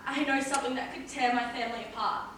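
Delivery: low, soft confessional murmur; hushed confession. Acted. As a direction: confide it quietly to the priest. Confessing secret to priest.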